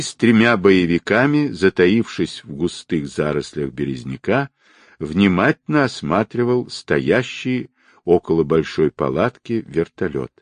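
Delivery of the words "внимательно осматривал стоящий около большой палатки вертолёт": plain reading voice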